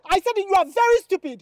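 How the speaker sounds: angry repeat. Acted. Girl repeating insult louder.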